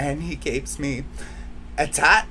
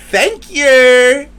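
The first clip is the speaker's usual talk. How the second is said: a much higher, louder, sarcastic Thank you; passive aggressive; 10 semitones higher, 13 dB louder than usual. Shown as a demonstration. Vlogger thanking those who hurt her, passive-aggressive and sarcastic. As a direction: sing out a fake-sweet Thank you. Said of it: "passive aggressively".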